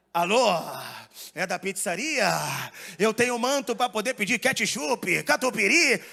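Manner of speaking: preacher cadence on a pizza order, gasping line ends; hammed-up imitation of a Pentecostal caller; Pentecostal pizza parody. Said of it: "paródia de um pentecostal pedindo pizza".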